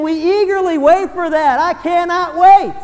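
slow, loud, high cry of longing; drawn-out excited yearning; eager longing. Acted. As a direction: stretch it out like you can hardly wait. Preacher voicing eager longing.

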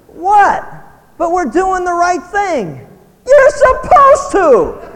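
high, loud exasperated disbelief; incredulous outburst. Real, not acted. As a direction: blurt it out, stunned. Preacher reacting in disbelief.

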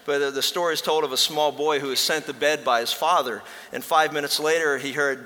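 usual speaking voice

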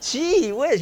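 high, loud exasperated exclamation; exasperated gee whiz. Retold or imitated. Pastor mimicking a worn-out parent, high and loud. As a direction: throw up your hands with a high gee whiz. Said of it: "it was like".

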